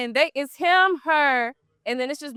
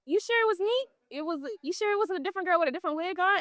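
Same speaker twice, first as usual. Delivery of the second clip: playing dumb; 6 semitones higher, 4 dB quieter, faster than usual. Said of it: "I play stupid".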